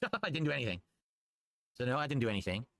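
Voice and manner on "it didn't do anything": laughing it off in disbelief. Real, not acted. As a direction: say it through a laugh.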